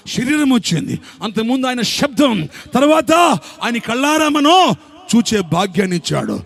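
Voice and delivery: high, loud, fervent sermon climax; preaching at full force. Acted. Pastor to congregation, fervent loud preaching.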